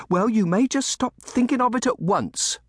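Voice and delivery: high, loud, stern nursemaid; fierce scolding. A narrator's reading. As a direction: shut the child's idea down sharply. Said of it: "throwing him a fierce frown".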